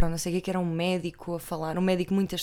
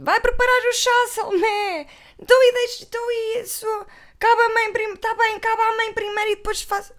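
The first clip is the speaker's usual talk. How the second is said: much higher, loud bossy calling; mock Teresa ordering; 14 semitones higher, 8 dB louder than usual. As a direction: boss the maid around in a shrill mock voice. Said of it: "a Teresa deve ter pensado".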